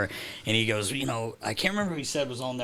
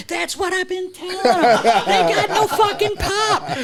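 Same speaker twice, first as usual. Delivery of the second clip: much higher, louder, laughing through it; laughing mock agreement; 9 dB louder than usual. Broadcaster retelling Phil laughing about weak sticks. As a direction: crack up while agreeing.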